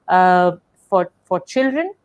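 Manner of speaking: normal talking voice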